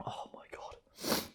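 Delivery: slow, voiceless gasp of suffering; overwhelmed whisper. Real, not acted. Eater suffering from poisonously hot soup, overwhelmed whisper. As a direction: breathe it out with no voice left.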